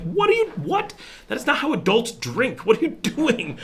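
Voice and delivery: high, loud incredulous scolding, with a laugh at the end; mock outrage. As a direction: scold them in disbelief.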